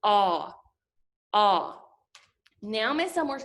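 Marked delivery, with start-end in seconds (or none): 0.0-1.8 just the short O, ah; short O sound. Shown as a demonstration. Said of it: "it's gonna say".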